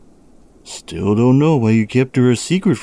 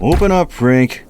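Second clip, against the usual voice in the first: calling through door; 6 semitones higher, 3 dB louder than usual. Acted. Officer knocking, calling out.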